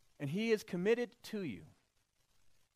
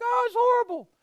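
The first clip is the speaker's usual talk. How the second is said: high loud wail; mock panic; 11 dB louder than usual. Pagan sailors fearing the storm, mock panic.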